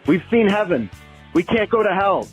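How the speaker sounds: ordinary talk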